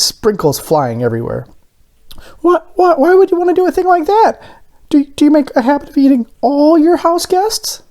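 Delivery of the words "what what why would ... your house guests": very high, loud, fast stammering donut; high alarmed donut. A narrator's reading. Arnie questions Mister Bing, high, loud and fast. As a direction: sputter in outrage.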